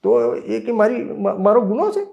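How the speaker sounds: put-on high, pleading cry of a hurt boy; tearful protest. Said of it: "હું પહેલી વખત રડ્યો".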